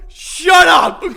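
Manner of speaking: loud, high, amused order for silence; playful real outburst; laughing shout. Real, not acted. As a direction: yell it through laughter. Fending off interruption mid-laugh.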